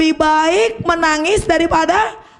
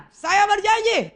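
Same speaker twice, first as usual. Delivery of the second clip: slow call; 7 semitones higher, slower than usual. Shown as a demonstration.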